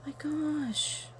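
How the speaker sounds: high, soft gasp of wonder. Real, not acted. Opening another gift from Bella Butterfly, soft gasp of wonder. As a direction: gasp as the next gift appears.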